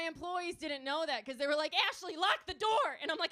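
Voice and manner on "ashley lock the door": loud, high mock-panicked order; panicked yell. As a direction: yell it in comic alarm. Employees panicking in shooting story.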